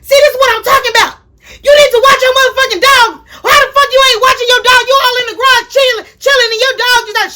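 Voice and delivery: high-pitched furious tirade; yelling at Amber. Retold or imitated. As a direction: get in her face and yell fast. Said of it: "I was like".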